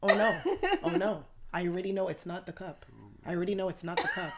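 quiet, rapid, fading repetition; blank dread. Retold or imitated. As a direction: murmur it to yourself, frozen with dread. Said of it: "with a blank stare".

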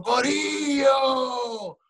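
hammed-up chant-like summons; mock shaman call. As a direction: call the crowd like a showy shaman. Voicing shaman addressing village.

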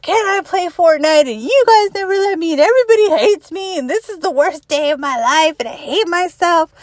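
mock a melodramatic teenager complaining; whiny teenager. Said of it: "they're screaming at you".

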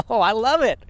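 usual speaking voice

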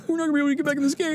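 high, rushed little angel voice; down-on-luck angels. Acted. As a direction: hurry it out high as a hapless angel. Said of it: "bunch of angels, like, down on their luck".

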